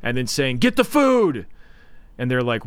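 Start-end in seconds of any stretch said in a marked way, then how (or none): 0.6-1.5 high, frantic imitated order; urgent mock shout. Man blocking a door orders the others, urgent mock shout. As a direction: call it out like a movie panic.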